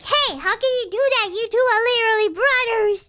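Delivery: mock dismay. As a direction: wail it like an outraged soap-opera sibling. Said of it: "And I'm like".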